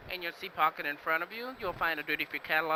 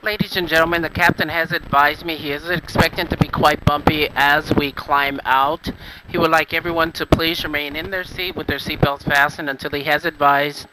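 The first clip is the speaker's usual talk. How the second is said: loud cabin intercom voice; PA announcement; 14 dB louder than usual. Real, not acted. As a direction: announce it over the plane's speakers. Flight attendant PA announcement.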